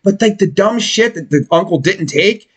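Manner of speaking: normal talking voice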